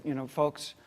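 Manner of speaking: serious. Retold or imitated. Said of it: "I said to them very seriously".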